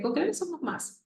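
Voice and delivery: put-on high child voice; imitating a small child. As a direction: repeat it absently like a child mid-play. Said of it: "y decía".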